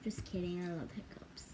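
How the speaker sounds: playful soft whisper. Real, not acted. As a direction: whisper the joke quietly. Whispered joke.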